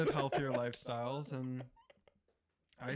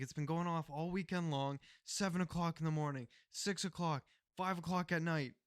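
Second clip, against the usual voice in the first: raised-pitch imitated tirade; pissed neighbor; 5 semitones higher than usual. Neighbor counting off alarm times, retold as pissed. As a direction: list the grievances like a fed-up neighbor. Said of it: "he was pissed".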